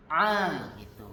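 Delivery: "A" pushed out from the throat as Arabic ain; ain demonstration. Shown as a demonstration. Teacher repeating the ain sound for the class. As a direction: squeeze "A" in the throat, then relax. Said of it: "agak tekan".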